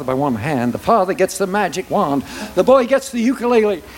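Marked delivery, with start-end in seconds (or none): none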